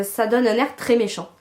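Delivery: ordinary talk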